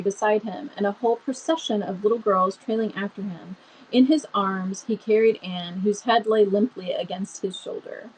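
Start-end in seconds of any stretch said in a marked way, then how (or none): none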